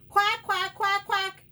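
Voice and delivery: high, blaring quacks; shouting duck. A narrator's reading. Noisy duck in the park beside the quail, shouting. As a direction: quack at full volume. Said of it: "shouts Dottie".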